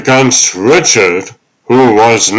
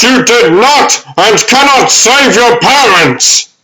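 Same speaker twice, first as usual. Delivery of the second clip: desperate shout; 12 semitones higher than usual. Acted. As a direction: shout at him to make him accept it. Alfred pleading with Bruce forcefully.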